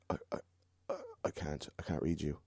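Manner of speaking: imitated stammer, very soft; halting, low-volume reluctance; hesitant, hushed refusal. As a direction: falter softly, as if disturbed by what you see. Psychic falters, refusing to read him.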